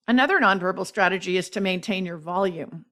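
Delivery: ordinary talk, nothing marked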